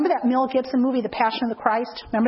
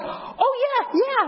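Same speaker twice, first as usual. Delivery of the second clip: very high startled oh yeah; sudden realisation; 12 semitones higher, slower than usual. Retold or imitated. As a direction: squeak it up as the penny drops. Kelly catching on after kick.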